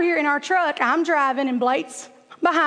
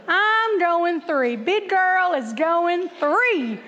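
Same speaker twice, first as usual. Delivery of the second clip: slow, drawn-out declaration, stretching THREE; mock-bold boast; 2 semitones higher, much slower than usual. Walker hyping herself up for the treadmill, slow mock bravado. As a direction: announce it grandly, word by slow word.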